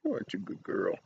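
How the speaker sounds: ordinary delivery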